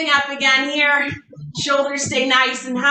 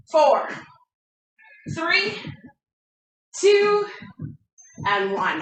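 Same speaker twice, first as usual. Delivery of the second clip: ordinary talk, nothing marked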